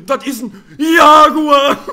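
slow, high, loud hammed-up scream; mock panicked scream. Gamer reenacting their jaguar scream for friend, mock scream. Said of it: "wir beide so".

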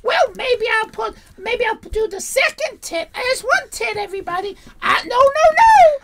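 put-on high-pitched gushing girl voice; giggly teenage girl. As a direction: play the overexcited teen streamer, high. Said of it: "do it more like the Giggly Girls".

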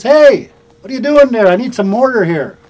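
loud, high call across the job site; shouting over. Retold or imitated. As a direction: holler across the wind for mortar. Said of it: "So I shouted over to him".

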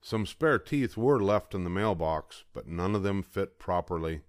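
usual speaking voice

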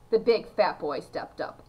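ordinary delivery

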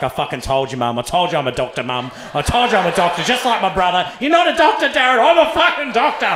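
building to a high, loud triumphant shout; mock triumphant rant; smug dentist. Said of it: "he's just like".

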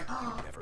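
short sharp mock gasp; a quick shocked intake of breath; imitated gasp. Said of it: "he's like, that one little, like, gasping face".

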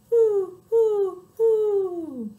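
high, loud owl hoots. Shown as a demonstration. Kids join the owl's call, high hoots. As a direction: hoot high, last one longer. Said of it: "flaps his wings and says".

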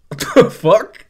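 high, loud, panicked yell; shocked yell. Retold or imitated. Alan, shook by Don's death, yelling in shock. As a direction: blurt it out in shock. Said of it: "Alan is now shook".

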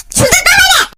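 mock furious shriek. Threatening the naughty game baby.

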